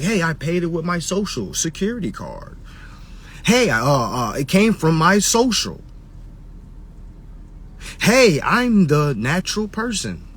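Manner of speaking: high, indignant mock caller; mocking sovereign caller. Mocking a clueless caller who threatens to sue. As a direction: lampoon a clueless caller insisting he owes nothing. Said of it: "say things like".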